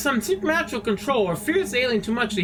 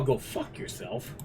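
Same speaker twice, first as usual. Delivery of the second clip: whispered insult; 8 semitones lower, 10 dB quieter, slower than usual. Real, not acted. Muttered insult under breath.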